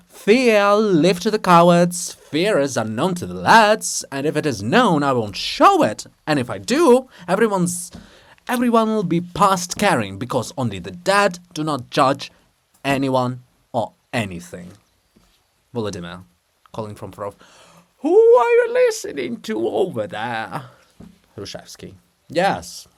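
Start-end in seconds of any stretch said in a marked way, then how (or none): 0.1-14.7 loud, defiant chant of bravado; defiant shout. Acted. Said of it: "breaking into a shout". 18.0-20.6 high, loud call across the room. Acted. Volodymyr calls out from afar.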